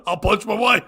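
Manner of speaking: loud mock-angry catchphrase; blustering sitcom husband, played for laughs; Ralph Cramden. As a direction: bellow it as a comic threat. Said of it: "Ralph Cramden's catchphrase was".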